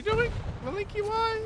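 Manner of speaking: a high, squeaky "why?" at the blunder; shocked cry. Real, not acted. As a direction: pitch jumps way up in disbelief. Shocked caster reacting to misplay.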